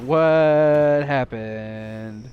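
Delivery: very slow drawn-out what happened; dumbfounded. Retold or imitated. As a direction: stretch it out, totally lost. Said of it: "I'm like".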